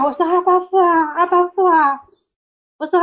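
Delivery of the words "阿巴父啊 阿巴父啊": high, imploring call to the Father; retold crying out. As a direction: re-enact a heartfelt call, pitched well up. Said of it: "我一呼喊". (高声恳切呼喊)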